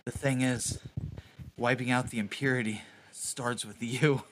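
soft, low, menacing murmur; whispered threat. Acted. Edgar murmurs to the dying cultist, soft and menacing. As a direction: breathe it into his ear coldly. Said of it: "I whisper in his ear".

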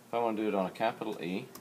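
fast, hurried run-through of the line; quick restatement while demonstrating. Real, not acted. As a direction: rattle it off quickly. Repeats the same sentence faster.

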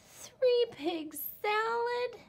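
very high, slow drawn-out moan. Acted. Little wolf smelling dinner, slow high moan. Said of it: "he moaned".

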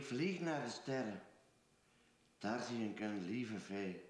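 slow deliberate reading with long pauses; poem reading. Acted. Reads his friend Peter's poem aloud for him, slow and careful. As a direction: read it out line by line, taking your time.

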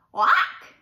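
high, very loud sudden whack; sharp impact exclamation. A narrator's reading. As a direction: punch the sound effect out. Acorn bops her on head.

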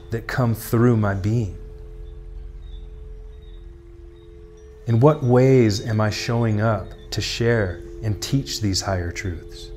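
measured, projected contemplative questions and affirmations; meditation prompts. Acted. Tarot guide voicing the listener's inner questions, solemn meditative delivery. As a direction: pose each thought deliberately, then leave long silence.

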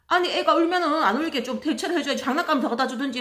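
loud, high indignant demand with a laugh; angry demand. Retold or imitated. Mother demanding toys from the crew, loud indignant tone. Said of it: "소리를 막 지르는 거예요".

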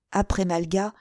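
plain reading voice

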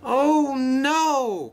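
high, drawn-out wail; dismayed cry. A narrator's reading. Luke watching the train crash, crying out in dismay. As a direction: cry out in horror. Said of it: "cried Luke".

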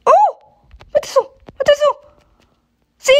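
high excited yell at the screen. Real, not acted. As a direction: shout for the ball to go in. Urging shot into goal.

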